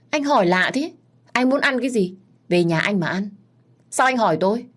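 high-pitched bickering comeback; exasperated retort. A narrator's reading. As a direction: throw the question back at them. Woman retorts in a bickering exchange.

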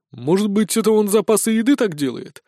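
high-pitched wry guess; wry amusement. A narrator's reading. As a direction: offer the theory with a smirk. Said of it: "усмехнулся Сисюля". (с усмешкой, высоким голосом)

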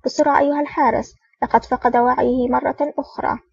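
ordinary delivery, nothing marked

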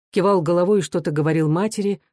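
ordinary delivery

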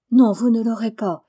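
loud, alarmed protest; frightened cry. A narrator's reading. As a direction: cry out in denial, moderately frightened. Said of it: "s'écria Jo ... d'un air effrayé".

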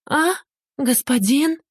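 high frightened squeak; panicked squeak. A narrator's reading. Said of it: "в панике пискнула".

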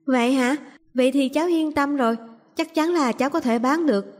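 put-on high, pleased child's voice; relieved little girl. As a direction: pipe up like a reassured small girl.

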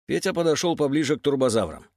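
ordinary delivery

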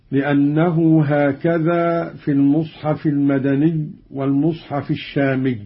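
ordinary talk, nothing marked

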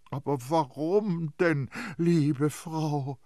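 high, cajoling question; coaxing. A narrator's reading. Priest coaxes the nurse.

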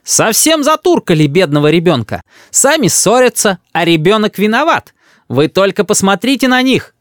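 high-pitched grandmother addressing an unseen audience; orator's indignation. A narrator's reading. Grandmother pleads to invisible spectators, oratorical. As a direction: denounce them like a tribune. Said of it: "говорит бабушка голосом трибуна".

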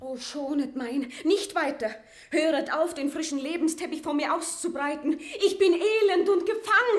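rising to a loud, high, broken cry; pleading rising into an anguished outcry; anguished plea. Acted. Maria begs him to stop.

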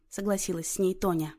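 ordinary delivery, nothing marked

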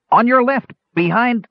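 very high, called-out alert; urgent warning. Acted. Gnome lookout to the knight mid-fight, urgent high warning. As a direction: warn him with pitch shot right up.

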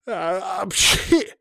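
high-pitched spelt-out sneeze; acted sneeze. Denis sneezes in the dusty classroom, acted sneeze. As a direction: build up, then sneeze the word out high. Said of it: "снова выдал Денис".

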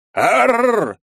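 high, loud roaring growl; battle roar. Acted. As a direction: roar yourself into a rage. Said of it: "зарычал я ещё раз". (боевой рык)

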